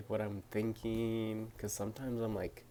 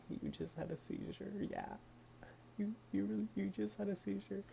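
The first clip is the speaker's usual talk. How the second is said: much higher, soft incredulous voice; shocked sister; 11 semitones higher, 7 dB quieter, faster than usual. Retold or imitated. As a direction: sound like you can't believe it. Sister shocked, repeating the news.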